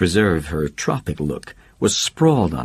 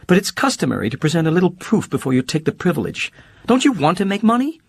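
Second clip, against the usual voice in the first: much higher, fast, sharp-tongued socialite; Holly cutting and quick; 11 semitones higher, faster than usual. Acted. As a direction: fire it back fast as Holly.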